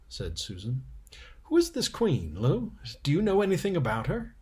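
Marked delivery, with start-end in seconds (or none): 1.4-4.4 put-on higher, eager girl's voice; curious little sister asking fast; Lucy voiced higher and quicker; young girl Lucy. Lucy's voice, asking about queen.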